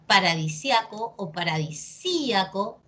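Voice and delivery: paradi-SIA-co, then paradi-SÍ-a-co; first paradisíaco on A, second on Í; second paradisíaco splits off a stressed Í; two accepted stresses. Shown as a demonstration. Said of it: "depende de ti cómo lo quieras decir".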